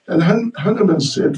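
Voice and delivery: ordinary delivery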